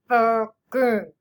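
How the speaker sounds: "Packing" in two loud muffled beats; whale talk. Shown as a demonstration. Teacher showing two syllables in whale talk. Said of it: "Let's whale talk".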